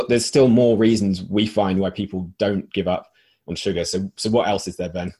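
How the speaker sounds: usual speaking voice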